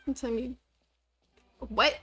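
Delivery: ordinary talk, nothing marked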